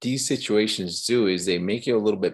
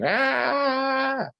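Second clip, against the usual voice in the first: high, loud yelp of alarm; loud cry out. Shown as a demonstration. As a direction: cry out so everyone hears. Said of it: "If I'm up here going".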